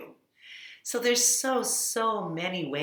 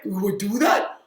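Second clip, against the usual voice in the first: puppet chef; 5 dB louder than usual. Acted. Puppet chef touched by offer.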